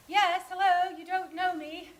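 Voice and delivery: over-projected telephone greeting; imagined phone caller. Acted. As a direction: introduce yourself loudly to a stranger. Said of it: "I say".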